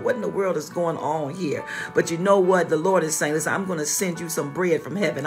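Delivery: usual speaking voice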